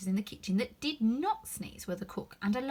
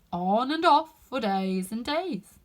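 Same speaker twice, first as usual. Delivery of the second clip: footman voice; 2 semitones higher, 8 dB louder, slower than usual. A narrator's reading. Footman repeating his remark.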